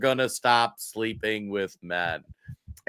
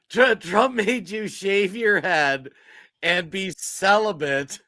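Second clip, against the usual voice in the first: higher, slow, savouring the joke; amused mocking repeat; 6 semitones higher, slower than usual. Real, not acted. Host echoing co-host's joke, amused and mocking. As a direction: relish the absurdity, drawn out.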